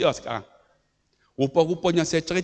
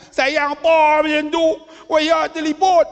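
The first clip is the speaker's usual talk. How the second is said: high, loud indignant retort; comic outraged complaint, pitched up; mock protest; 9 semitones higher, 6 dB louder than usual. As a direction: protest loudly in a raised, put-out voice. Mock protest at father's beating.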